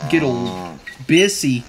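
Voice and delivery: plain reading voice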